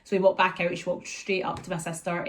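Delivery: usual speaking voice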